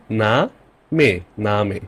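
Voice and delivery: না and মে apart, then joined as 'naa-meh'; syllable breakdown. Shown as a demonstration. Teacher breaks the German word down, না 'naa', মে 'meh'. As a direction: build it up: না, মে, না মে. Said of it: "ই কে এ বলে".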